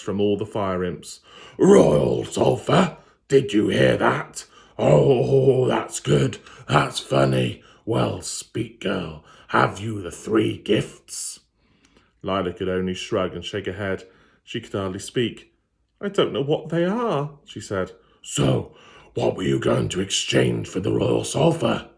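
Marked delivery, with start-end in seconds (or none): 1.6-7.6 loud, slow jeering demon voice; mock her words back at her; mocking laughter. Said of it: "laughed even harder". 7.8-11.4 slow, lightly voiced demanding demon; stern fiend. Fiend questions Lila. 16.0-17.4 put-on higher, small frightened girl voice; timid girl. Lila answers the fiend. 18.2-21.9 demon voice, pressing; mild roar. Acted. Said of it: "he roared".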